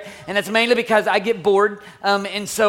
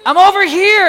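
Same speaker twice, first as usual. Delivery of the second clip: put-on high, loud kid's holler; calling back; 9 semitones higher, 10 dB louder than usual. Son gives his searching dad directions, hollering. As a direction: yell back from far away. Repeats son's distant call.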